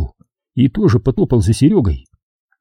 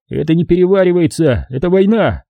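raised, grim consoling buddy; soldier comrade voice; 6 semitones higher than usual. Acted. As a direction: tell him it's war, not food. Sashka consoles narrator.

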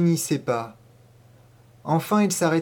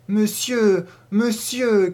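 frightened cry; 6 semitones higher, much slower than usual. Acted. Girl struggling against the boy, crying out in fear. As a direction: cry out for help while struggling. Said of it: "criai-je en me débattant".

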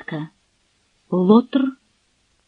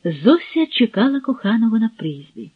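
ordinary delivery, nothing marked

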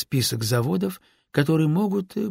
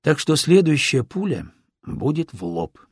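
ordinary delivery

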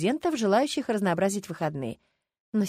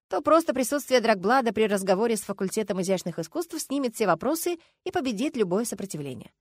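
ordinary delivery, nothing marked

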